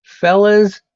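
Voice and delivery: loud, high hail; call out. Real, not acted. Streamer calling to his friends, loud high hail. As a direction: call the fellas over.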